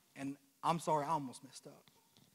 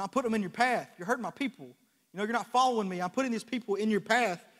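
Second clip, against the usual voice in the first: raised, louder rebuke as God; God's stern rebuke, raised and louder; voice of God rebuking; 5 semitones higher, 7 dB louder than usual. Retold or imitated. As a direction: preach God's warning, louder and higher. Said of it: "He said".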